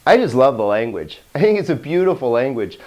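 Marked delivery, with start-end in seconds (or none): none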